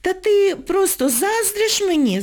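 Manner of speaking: high, slow, condescending jab; haughty. A narrator's reading. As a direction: talk down to her. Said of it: "зверхньо кинула їй Ніночка".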